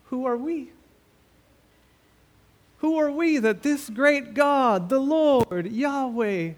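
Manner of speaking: high, loud preaching climax; impassioned preaching. Acted. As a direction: press the question home. Preaching at full force.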